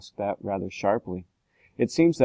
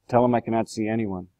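usual speaking voice

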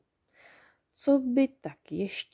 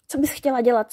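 eager goblins, a touch louder; curious goblins asking; 4 semitones higher, 6 dB louder, faster than usual. A narrator's reading. Goblins ask the fairy eagerly, a little louder. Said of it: "ptali se zvědavě".

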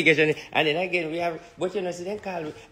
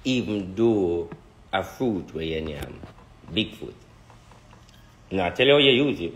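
lower, slow mystic teaching voice; mock spiritual lesson; 6 semitones lower, slower than usual. Schoolroom class on obeah for the viewers, lower, slow mystic voice.

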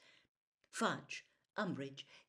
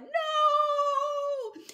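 very high, loud cry; 12 dB louder than usual. A narrator's reading. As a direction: scream it out in panic. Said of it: "somebody cried".

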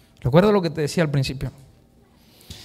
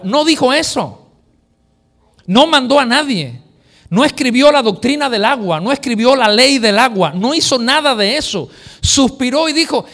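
loud, higher fervent preaching; preaching at full force; 7 semitones higher, 5 dB louder than usual. Acted. Preacher stirs the congregation, fervent loud preaching. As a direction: drive it home to the crowd.

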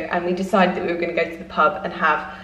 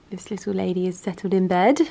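normal talking voice

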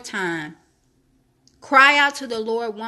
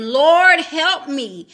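pleading cry; 3 semitones higher, 5 dB louder, much slower than usual. Acted. Woman kneels, cries to Jesus.

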